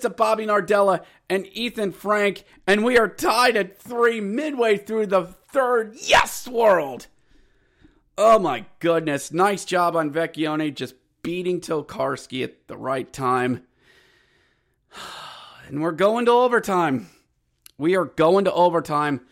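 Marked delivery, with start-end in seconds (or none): none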